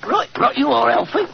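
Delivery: a touch of put-on Cockney; weak Cockney accent. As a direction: try a Cockney voice, not very well. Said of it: "My Cockney accent doesn't compare with yours".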